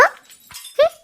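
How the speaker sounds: high, soft dismissive hum; a sniff of contempt before walking out; high scornful hmm; scoffing hmm. Acted. Maid scoffs before leaving.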